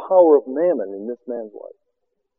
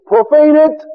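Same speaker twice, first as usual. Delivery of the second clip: high, loud cry of command; exhorting shout; 11 semitones higher, 7 dB louder, slower than usual. Real, not acted. Preacher urges congregation to give, shouting high. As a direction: fire it at them, pitched high and loud.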